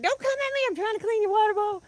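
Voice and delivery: high, pleading voice; mock pleading. Pleading with chickens that charged her, high voice. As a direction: beg the birds to back off.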